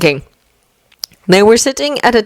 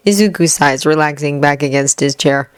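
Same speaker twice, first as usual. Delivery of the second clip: plain reading voice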